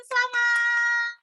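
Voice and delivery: high, slow, drawn-out cheer of selamat; excited congratulation. Real, not acted. Host congratulating the prize winner from Palembang, drawn-out and excited. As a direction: stretch the word out like a happy host.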